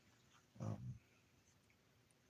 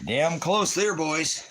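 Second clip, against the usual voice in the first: much higher, louder sudden yell; excited shout; 12 semitones higher, 8 dB louder, slower than usual. Real, not acted. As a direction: cheer it out like a near-miss. Excited near-miss while playing.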